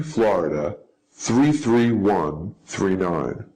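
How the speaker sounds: normal talking voice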